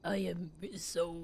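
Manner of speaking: slow, low, soft disguise voice; fake voice. Gamer rehearsing as a robber, fake voice. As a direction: put on a deep robber voice, slowly. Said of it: "I'll use that voice".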